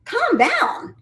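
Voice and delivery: high-pitched mock disbelief; squeaky-high, indignant repeat of the advice; incredulous echo.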